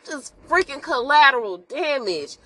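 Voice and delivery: a hint of a sobbing wail over the loss; real upset outcry. Real, not acted. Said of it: "it was so sad".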